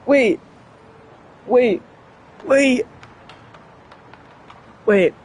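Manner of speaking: ordinary talk, nothing marked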